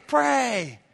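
rising preacher's exhortation, building to shouted prays; preaching. Acted. Preacher urging the church not to lose heart, building fervor. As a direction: preach it with growing fire.

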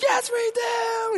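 put-on high, slow child shout; eager kids. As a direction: shout it like an overexcited kid.

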